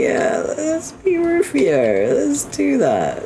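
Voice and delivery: very high, slow, stretched words; silly drawn-out squeal. Pretend. Silly drawn-out repeat of plan.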